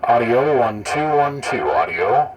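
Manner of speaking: radio speaker sound; as heard over a CB radio. Real, not acted.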